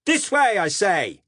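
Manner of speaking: loud, high commanding yell; desperate shout. Acted. Narrator insisting the cops follow him, desperate shout. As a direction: force them to turn with your voice.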